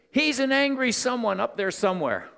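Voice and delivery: imitating her unsmiling outburst, pitched high; retold bitter outburst. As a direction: throw it out high, as her angry answer about God. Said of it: "There was no smile".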